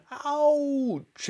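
very high squawked yelp; yelping hen. A narrator's reading. Brown hen tasting the medicine, high-pitched yelp.

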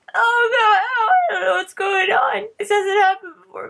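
high, loud, crying panic; panicked. Real, not acted. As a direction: cry out in sudden fear.

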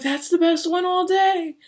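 high, loud, slow delighted praise; doctor praising the patient's swallow, high and loud; enthusiastic praise. Retold or imitated. Doctor praising patient after the test, high delighted.

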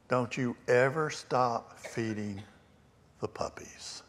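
slow, then fading into a whisper; whispered tender words. Retold or imitated. Grandmother hugging young Johnny, slow tender whisper. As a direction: hush it like a loving secret.

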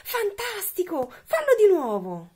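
high-pitched storybook enthusiasm; excited exclamation. A narrator's reading. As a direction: cheer the dragon on with gushing wonder. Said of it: "ma Elizabeth esclamò".

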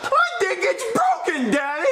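high whining shout; whiny shout. Acted. Child to dad about his hand, whiny shout.

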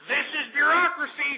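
emphatic rant. Real, not acted. Host rants at leftists about government bureaucracy, indignant.

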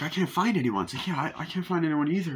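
lightly acted worried searcher; mock worry. Retold worried searchers in the film.

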